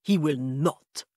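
slow, firm vampire promise; firm vampire father. A narrator's reading. Vampire father insisting his son will behave, in a firm slow vampire accent. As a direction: lay it down slowly like a stubborn count.

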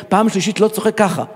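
ordinary delivery

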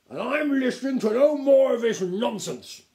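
loud, high, indignant outburst; blustering, scornful aristocrat; snapping duke. A narrator's reading. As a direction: refuse to hear another word. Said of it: "snapped the Duke".

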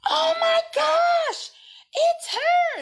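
high, drawn-out fangirl shriek; imitated excited scream. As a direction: squeal it loud, like a star-struck fan. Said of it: "comes running out from the back screaming".

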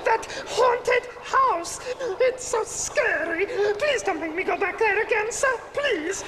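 high, fearful begging; frightened pleading. Acted. Henchman begging the boss about the haunted house, frightened pleading. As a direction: beg not to be sent back. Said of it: "I'm frightened".

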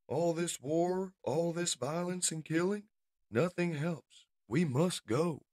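high-pitched lament over war; weary pacifist. Acted. Beachcomber tells Spike they must leave, pleading.

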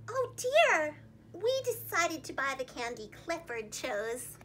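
plain reading voice

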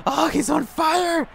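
high, stretched-out excitement; amazed high cry. Real, not acted. Gamer hyping the player on fire, high excited cry.

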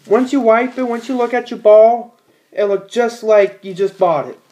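usual speaking voice

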